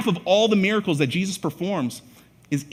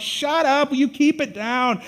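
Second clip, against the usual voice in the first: rebuking; 10 semitones higher than usual. Retold or imitated. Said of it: "Those who led the way rebuked him".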